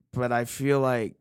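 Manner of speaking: ordinary talk, nothing marked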